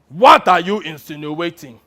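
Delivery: loud, affronted warlord challenge; African warlord. Acted. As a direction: demand it like a hammed-up general. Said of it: "in his best impression of an African warlord".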